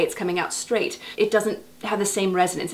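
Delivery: normal talking voice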